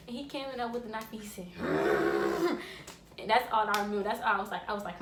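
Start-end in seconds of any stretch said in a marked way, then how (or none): 1.5-3.1 loud, very low drawn-out growl; imitated menacing noise. Uncle coming in with the knife, mock growl. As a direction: mimic a long, low rumbling growl.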